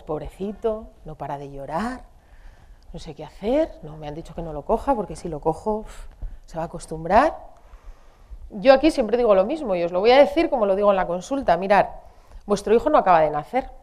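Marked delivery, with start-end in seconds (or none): 0.0-7.4 low, subdued worried muttering; mildly acted hesitant, anxious parent; torn parent fretting. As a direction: voice a helpless parent's guilty doubts softly. Acts out a torn, guilty parent.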